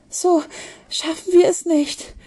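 high, breathless despair; gasping. A narrator's reading. Charlotte dragged along by Ben, gasping. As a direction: gasp it out while being dragged along. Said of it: "japste sie".

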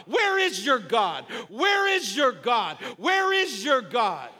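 slow, hammered shout repeated like a challenge; preached taunting yell. Acted. Devil and circumstances yelling at a believer, preached taunting yell. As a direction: yell it as the accuser, drawn out. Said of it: "That's what circumstances are yelling at you".

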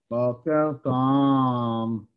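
low, very slow dragged בוקר טוב; gloomy good morning. Shown as a demonstration. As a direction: drag the greeting out heavily. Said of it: "הרגשה אחרת לגמרי". (בוקר טוב איטי וכבד, נמוך)

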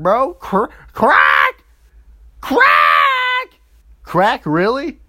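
high, loud squawked cracked cries; squawking bird. Acted. Mocking own shock by squawking at friends like a bird. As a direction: screech "crack" like a startled bird. Mock shrieking reaction, bird-like.

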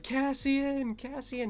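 high, drawn-out calling of a name; squealed, sing-out call to a player; ballistic fan. Retold or imitated. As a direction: re-enact an overexcited fan calling out. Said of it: "went ballistic".

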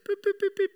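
high, even beep imitation; quick monotone beeps; car alert beeping. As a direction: imitate a small warning alarm. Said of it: "gives you a little beep".

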